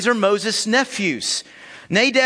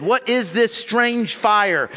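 ordinary delivery

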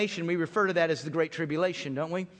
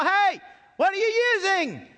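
high, loud call across the water; yelling over the river; 12 semitones higher, 5 dB louder than usual. Retold or imitated. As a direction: shout to dad over the rapids. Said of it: "You have to yell".

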